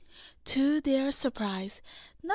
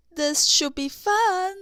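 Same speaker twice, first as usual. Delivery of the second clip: cheerful; 6 semitones higher, 8 dB louder than usual. A narrator's reading. Anna cheerful before climbing.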